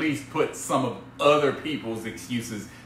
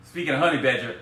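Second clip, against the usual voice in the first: ordinary talk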